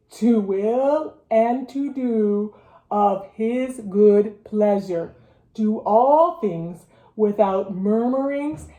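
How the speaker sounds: slow, drawn-out verse recital; low and slow. Shown as a demonstration. Teacher leading Scripture Spies in review verse, low and slow. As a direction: stretch each word out steadily. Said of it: "We're gonna do low and slow".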